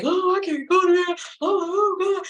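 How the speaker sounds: high, loud, panicked wail; crying scream. Retold or imitated. She panics about the court letter, crying high and loud. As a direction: wail in terror like you're about to be locked up. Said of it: "I'm screaming, I'm crying".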